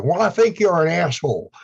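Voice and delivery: high, loud mock Texan bluntness; blunt Texan, acted. Imagined Texan telling him off to his face, bluntly acted. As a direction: blurt it out as a frank Texan would. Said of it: "Whereas in Texas, I'm more likely to hear".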